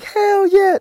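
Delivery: leaps up high, eager; eager yes. Retold or imitated. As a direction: jump at the invite. Eagerly accepting invite.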